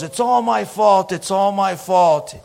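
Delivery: high, loud self-blame; high-pitched, hammed-up wail of apology; distraught stranger. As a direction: imitate a frantic man taking the blame. Imitating the stranger blaming himself.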